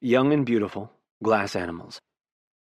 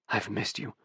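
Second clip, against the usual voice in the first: softer, hushed confession; whisper; 2 semitones lower, 9 dB quieter than usual. A narrator's reading. Oliver telling Gavin, softly hushed. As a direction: breathe it close to his ear. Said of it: "I whisper".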